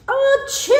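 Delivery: big high sneeze; loud sneeze. Acted. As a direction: burst out with a huge sneeze. Bear sneezes from pepper.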